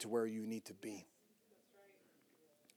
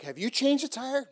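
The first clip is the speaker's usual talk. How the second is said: mock disbelief; 10 semitones higher, 4 dB louder than usual. Mocking quiz of the boys.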